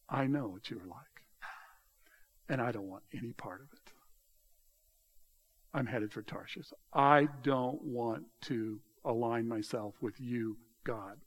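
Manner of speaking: low, quiet refusal; Jonah's refusal. Acted. Jonah turns away from God, quietly refusing. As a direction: refuse under the breath, voice dropped. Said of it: "Jonah is saying".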